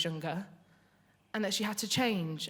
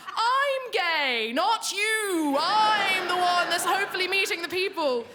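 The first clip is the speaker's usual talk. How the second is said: high, loud, laughing exasperation; exasperated retort; 9 semitones higher, 5 dB louder, slower than usual. Retold or imitated. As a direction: protest in mock outrage.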